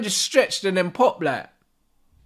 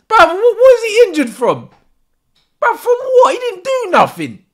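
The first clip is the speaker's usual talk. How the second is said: high, raised-voice disbelief; incredulous outburst; 9 semitones higher, 4 dB louder than usual. Real, not acted. Fan baffled at a player's injury, incredulous outburst. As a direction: demand an answer, baffled and exasperated.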